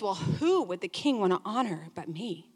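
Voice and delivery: smug. Acted. Smug Haman voiced.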